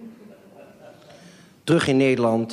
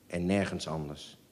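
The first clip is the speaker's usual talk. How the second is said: much softer, fading away; fading echo; 10 dB quieter than usual. Shown as a demonstration. Narrator softly echoing en nergens anders, fading. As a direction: let the repeated en nergens anders die out softly.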